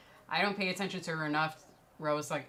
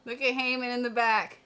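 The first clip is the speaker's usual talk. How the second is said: excited; 9 semitones higher, 8 dB louder than usual. Real, not acted. Excited pointing at arena.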